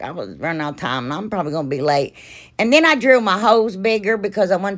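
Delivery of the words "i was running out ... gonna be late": quick, hurried aside; rushed. Real, not acted. Admitting she ran out of time, fast and hurried. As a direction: rattle it off fast.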